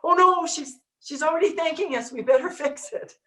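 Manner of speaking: high, hurried mock alarm of little helpers; flustered underlings scrambling, ending in a laugh; panicked minions. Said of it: "then the minions have to".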